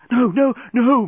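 high, loud moan. A narrator's reading. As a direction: wail it in protest. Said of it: "moaned the journalist".

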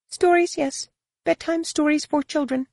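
high-pitched, cool dismissal; a guide coolly brushing off the question, pitched very high; frosty. A narrator's reading. Guide dismisses Jacen's questions about stories, frosty. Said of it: "the Feroan's frosty tone".

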